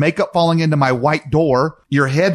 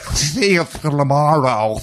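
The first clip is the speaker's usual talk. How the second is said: very slow, smeared imitation; slurred daughter; much slower than usual. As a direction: slur it into one drawn-out mush. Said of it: "We're even clearer. It's like".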